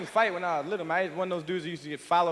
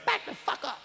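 put-on little kid voice; 8 semitones higher, 4 dB quieter than usual. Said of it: "six-year-old with a gun saying".

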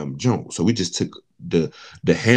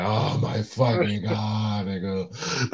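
slow dismay; 6 dB quieter, much slower than usual. Retold or imitated. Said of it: "I'm in this bitch like".

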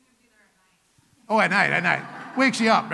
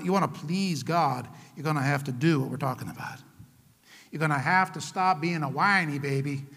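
usual speaking voice

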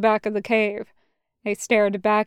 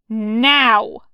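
loud, drawn-out order; forceful one-word command; 5 dB louder than usual. A narrator's reading. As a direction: cut off any objection. Order over Snag's objection.

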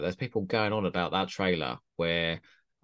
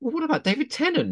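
retort. Retold or imitated. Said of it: "So immediately I went".

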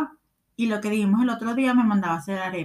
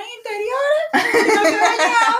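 laughing, much higher pitch; 11 semitones higher, slower than usual. Real, not acted. Telling a friend about the cousin still in his underwear, laughing high. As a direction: squeal it through laughter.

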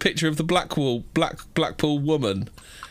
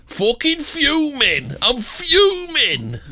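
slow, drawn-out, higher mock rant; mock fuming woman; 9 semitones higher, 5 dB louder, much slower than usual. Quoting a furious shopper, slow and exaggerated. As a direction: drag out fuming like an outraged customer. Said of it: "L Kelly says".

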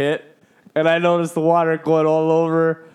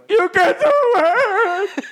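very high, drawn-out mock scream; hammed-up frantic cheering; 4 dB louder, much slower than usual. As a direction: squeal encouragement in mock desperation. Retold frantic cheering during delivery.

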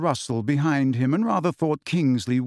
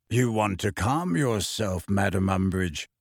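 deep, slow, calm warning; slow deep bass caution; low unhurried voice; 6 semitones lower than usual. Acted. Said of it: "in his deep, slow voice".

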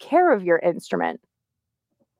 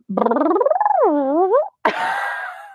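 high squealing laugh; 9 semitones higher than usual. Shown as a demonstration. Vocal exercise laugh.

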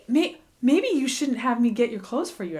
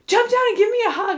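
pleading plea; 11 semitones higher, 5 dB louder, faster than usual. Retold or imitated. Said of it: "I'm gonna start crying right now".

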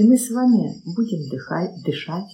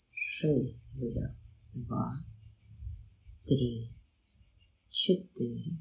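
soft, slow breathing count; 3 semitones lower, 10 dB quieter, much slower than usual. Acted. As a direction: count the breaths softly and calmly. (медленный счёт дыхания)

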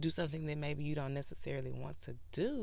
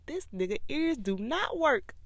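God's exasperated complaint, higher and louder; mad God; 10 semitones higher, 7 dB louder than usual. Retold or imitated. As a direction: vent like a fed-up God. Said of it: "And God up there mad".

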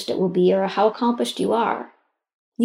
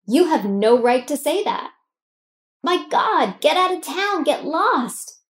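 higher, indignant dismissal of the critic; defiant telling-off; 5 semitones higher, slower than usual. Shown as a demonstration. Coach showing how to tell off the inner critic, indignant. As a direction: push back hard, like defending a friend. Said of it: "Tell it, tell it how you feel".

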